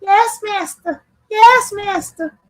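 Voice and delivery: high, slow servant reply; a creepy minion bowing to his master; obedient servant voice. Pretend. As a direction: answer like a summoned creature. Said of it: "Beetlejuice must have been in that bitch".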